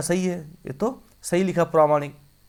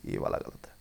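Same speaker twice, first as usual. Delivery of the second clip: whisper; 11 dB quieter than usual. Real, not acted. Teacher aside, voiceless.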